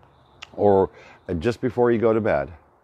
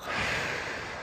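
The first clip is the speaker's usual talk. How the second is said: long, slow breath through the nose; audible deep-breathing demo; demonstrated deep breath; 12 dB quieter than usual. Shown as a demonstration. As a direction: breathe deeply and audibly to show the exercise. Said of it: "breathing in through my nose".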